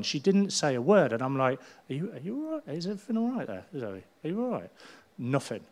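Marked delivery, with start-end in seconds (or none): 1.9-4.7 concerned check-in. Retold or imitated. Checking on silent friend Zoe.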